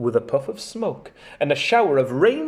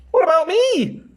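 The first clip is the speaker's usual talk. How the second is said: 1 sad Dorothy; 11 semitones higher, 3 dB louder than usual. Acted. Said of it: "said Dorothy sadly".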